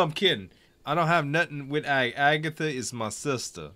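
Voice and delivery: ordinary talk, nothing marked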